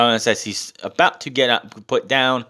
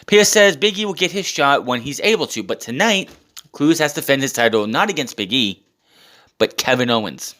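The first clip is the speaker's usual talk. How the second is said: ordinary talk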